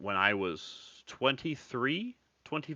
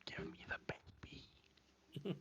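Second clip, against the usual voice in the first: creepy whispered imp; Rumpelstiltskin; 3 semitones higher, 22 dB quieter, faster than usual. Acted. Fairy-tale villain demanding the child, creepy whisper. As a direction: whisper it like a baby-snatching goblin. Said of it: "I'm like Rumpelstiltskin over here".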